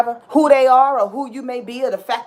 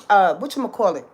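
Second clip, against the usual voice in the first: ordinary talk, nothing marked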